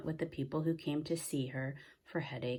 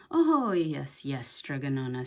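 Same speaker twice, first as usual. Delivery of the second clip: eager yes; 8 dB louder than usual. A narrator's reading. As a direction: agree too fast, hiding a plan. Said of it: "said Big Anthony".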